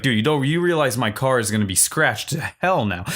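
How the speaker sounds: usual speaking voice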